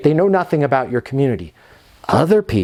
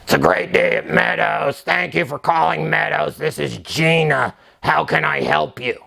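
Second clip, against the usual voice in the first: put-on South Boston accent. Receptionist Gina answering calls in a South Boston accent. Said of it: "never ... say your name when you answer".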